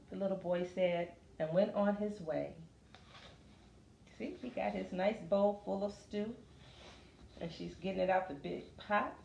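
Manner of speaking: lively, sing-song picture book reading; reading to children. Acted. Teacher reading a picture book to a class, lively.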